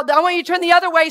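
ordinary talk

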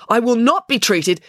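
high, loud, drawn-out outrage; indignant protest. Acted. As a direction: protest slowly and loudly, highly indignant.

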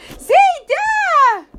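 loud high alarmed shout; alarmed cry. Acted. Boy hiding behind the door, calling grandfather in a loud alarmed cry. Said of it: "in an alarming voice".

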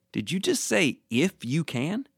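softly incredulous, rising at the end; incredulous questioning. Retold or imitated. Jesus looking at the doubting father, mildly incredulous. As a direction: quote it back in mild disbelief. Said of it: "looking at him saying".

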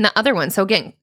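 ordinary talk

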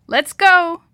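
high, loud call to the group; yell. A narrator's reading. River guide calls kids back to the raft, yelling. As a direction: yell it out to round everyone up. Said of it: "Jake yelled".

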